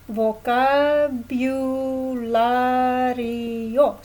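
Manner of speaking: Vocabulario said slowly in Spanish, rolled and stretched; Spanish pronunciation. Shown as a demonstration. Teacher modeling Vocabulario for students, slow Spanish. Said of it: "Let's say, um, vocabulary".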